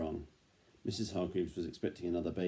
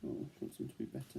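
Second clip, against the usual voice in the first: whisper; 7 semitones lower, 11 dB quieter, faster than usual. Real, not acted. Reader whispers an aside to child.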